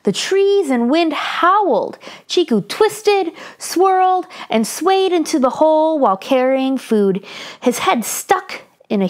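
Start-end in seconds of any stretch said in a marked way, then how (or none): none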